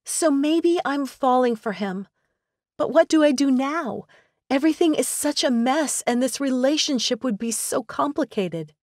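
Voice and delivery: high, tearful, voice cracking; voice breaking. A narrator's reading. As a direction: near tears, confessing. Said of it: "her voice breaking".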